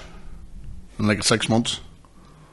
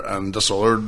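normal talking voice

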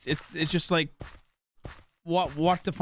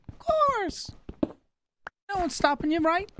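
very high, drawn-out silly voice; squeaky mock reply; 13 semitones higher, slower than usual. Answering a viewer asking to donate fifty dollars, squeaky mock voice. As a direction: stretch it out in a comic high pitch.

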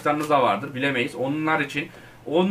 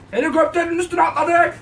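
much higher, loud triumphant yell; excited shout; 11 semitones higher, 4 dB louder than usual. Real, not acted. Gamer landing on the helicopter, excited high shout. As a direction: shout it out as you land the jump.

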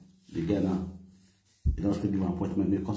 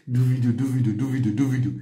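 mock nagging; 2 semitones higher, 4 dB louder than usual. Mocking the wife's nagging.

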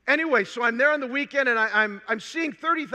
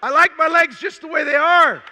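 loud, higher, laughing through the line; laughing retort; 5 semitones higher, 8 dB louder than usual. Retold or imitated. Young man answering his sister's healing talk, laughing loudly. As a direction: crack up as you push back on the idea.

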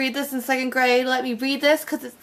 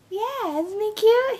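cooing over bookmark; 7 semitones higher, slower than usual. Real, not acted. Showing off her Charmander bookmark.

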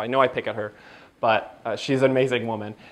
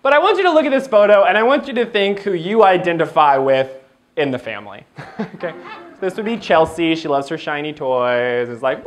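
normal talking voice

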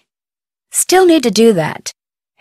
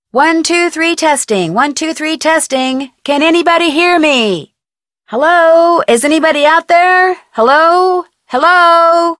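radio check; 7 semitones higher than usual. Real, not acted. Testing radio, calling.

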